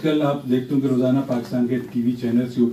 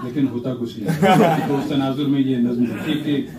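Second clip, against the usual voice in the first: ordinary talk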